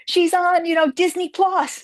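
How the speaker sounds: put-on high, eager girl fan voice; imitated young girls. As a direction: mimic a teenage fan explaining, pitched high. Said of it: "little girls came up to me".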